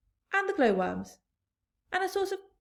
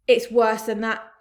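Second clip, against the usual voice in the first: louder grim cry; cried out; 11 dB louder than usual. A narrator's reading. Police chief crying out to fire chief. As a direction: call it out darkly. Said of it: "cried the chief of police".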